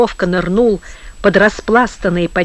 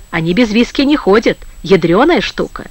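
plain reading voice

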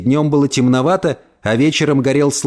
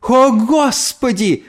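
high, long-drawn wail; anguished cry; 10 semitones higher, much slower than usual. A narrator's reading. Mother clutches her head after the insult, anguished cry. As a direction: cry out as if in sharp pain. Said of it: "закричала она, словно от острой боли".

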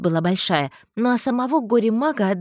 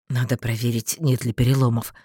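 low male voice; subdued lowered man's voice; lightly voiced man; 8 semitones lower than usual. A narrator's reading. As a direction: agree in a deeper register. Male friend agrees nervously.

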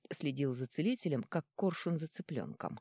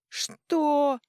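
loud furious snap; snarl; 11 semitones higher, 9 dB louder than usual. Acted. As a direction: snap like a beast. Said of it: "вызверился Ретьярд".

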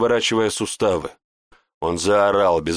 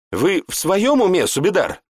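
incredulous high question; woman astonished at him; surprised; 9 semitones higher than usual. A narrator's reading. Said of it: "удивилась женщина".

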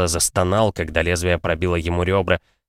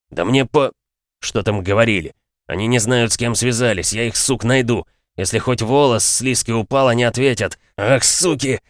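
put-on angry young man; 4 semitones higher than usual. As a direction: spit out threats in a rage. Vlad raging about Liza.

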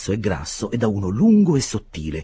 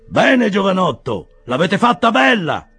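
slow, much higher and louder sarcastic bearer; short bearer's voice; 12 semitones higher, 7 dB louder than usual. A narrator's reading. Short bearer mocking the locked-out young man, slow and loud. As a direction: savour the young man's blunder. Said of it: "disse il portatore basso".

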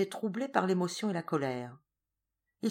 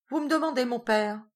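breezy, unconcerned, pitched higher; offhand, casual air; 6 semitones higher, 5 dB louder than usual. A narrator's reading. Son answering his father's summons, breezy and offhand. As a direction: toss it off as if nothing is wrong. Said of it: "Georges d'un air dégagé".

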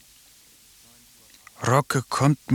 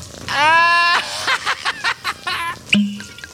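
big burst of laughter. Acted. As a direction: throw your head back and howl with laughter.

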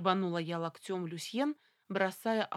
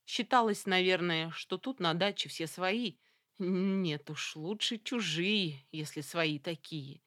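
ordinary delivery

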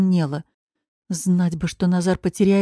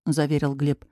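ordinary delivery

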